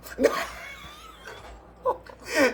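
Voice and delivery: shrill burst of laughter at own mean joke; big scandalized shriek of laughter. Real, not acted.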